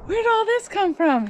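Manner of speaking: put-on high child voice. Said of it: "Hunter's like".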